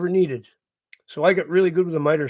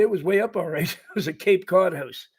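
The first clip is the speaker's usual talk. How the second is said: ordinary delivery, nothing marked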